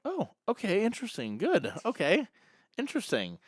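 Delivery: high-pitched, hesitant "oh, okay"; taken aback. Retold or imitated. Telling how he answered his friend's news, taken aback. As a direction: react with mildly thrown, rising interest. Acts own stunned reply to friend.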